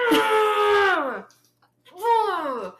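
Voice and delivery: high, loud frustrated "ah"; exasperated scream. Retold or imitated. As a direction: let out two pent-up cries of frustration. Acts out her exasperated reaction.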